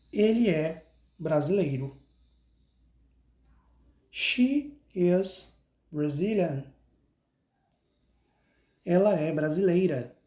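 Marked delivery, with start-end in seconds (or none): 4.1-6.7 slow, clear "I am Brazilian" for pupils to repeat; slow model sentence. Shown as a demonstration. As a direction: say each word of "I am Brazilian" slowly so they can copy. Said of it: "Então, repita comigo". (devagar e claro, para o aluno repetir)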